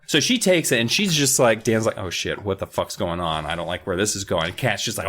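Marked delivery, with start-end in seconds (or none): 2.0-4.5 nervous mutter. Retold or imitated. Dan scared of Kat.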